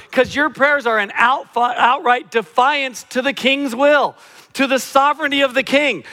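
high, loud, driving sermon; full-force preaching. Real, not acted. Preacher confronting the congregation about their prayers, preaching at full force.

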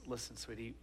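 soft, tender lead-in to bad news; quiet fatherly softness; careful dad. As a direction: gently get a child's attention. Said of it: "in the best way he knows how".

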